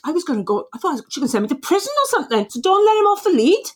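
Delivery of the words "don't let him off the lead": much higher and louder, imitating the vet; emphatic vet order. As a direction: stress the vet's firm warning. Vet's instruction retold emphatically.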